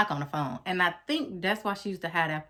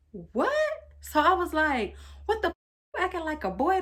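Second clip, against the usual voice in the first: much higher, stunned outburst; shocked; 11 semitones higher than usual. Retold or imitated. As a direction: gasp it out in disbelief. Hearing ex answer the phone.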